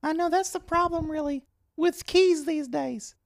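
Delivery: high-pitched awkward store guy; squeaky oddball coworker voice; high character voice. Acted. Clint character continues.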